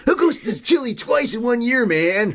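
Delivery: high, slow, incredulous rant; mock indignant. Podcast host quoting the incredulous character, high and slow. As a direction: play the baffled sceptic.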